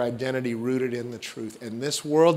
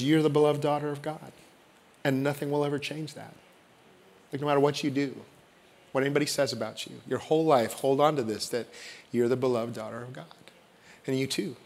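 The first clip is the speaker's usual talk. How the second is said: softer, hushed words of blessing; tender, softer blessing spoken over the congregation; 3 semitones lower, 8 dB quieter than usual. Acted. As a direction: speak it gently over each person. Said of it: "when words are spoken over you".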